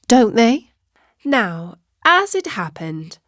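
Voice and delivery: usual speaking voice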